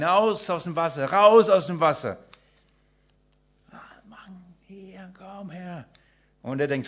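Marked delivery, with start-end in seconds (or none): 0.0-2.2 loud urgent yell, louder on the repeat; shouting warning. Acted. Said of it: "die Leute schreien". 3.7-5.8 urgent slow whisper. Acted. Rescuers call quietly to man.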